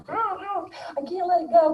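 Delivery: high, slow strained whine; mock stewing. Neurotic clinging to an old grudge, mock whining. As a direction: drag it out as a mock grudge-holder's lament.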